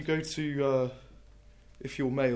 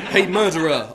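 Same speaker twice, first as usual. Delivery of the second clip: louder mock shout; yell; 3 semitones higher, 12 dB louder than usual. Poet greeting the murderer, loud mock yell. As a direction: call out cheerily across the room. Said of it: "I yell".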